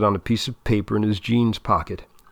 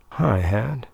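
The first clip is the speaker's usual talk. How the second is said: low sultry purr; syrupy seductive voice. Acted. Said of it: "That syrupy, low, seductive voice".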